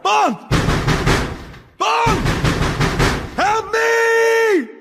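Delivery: all-out cry for rescue; desperate shout. Acted. Man trapped in the bath shouts desperately for Bond. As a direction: scream the name, high and loud.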